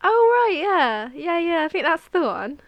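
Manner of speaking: loud, high-pitched playing along; mock agreement. Going along with co-host's wrong song, mock agreement. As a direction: pretend it all makes sense, loudly.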